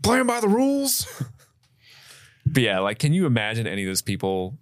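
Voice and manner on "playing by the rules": much higher hammed-up finish to the rant; mock John Goodman. Host completing co-host's Lebowski quote, high mock voice. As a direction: finish the quote in a silly squeaky parody. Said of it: "John Goodman version".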